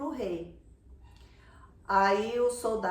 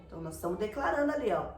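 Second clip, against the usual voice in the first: ordinary talk, nothing marked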